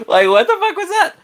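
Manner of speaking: high, laughing disbelief; real laughing. Real, not acted. Reacting to Mystique's weird turn, laughing.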